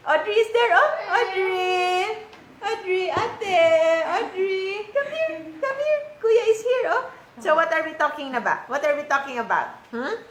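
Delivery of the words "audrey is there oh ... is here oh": high, stretched sing-song call. Real, not acted. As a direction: coax a little one over, laughing. Calling little Audrey over.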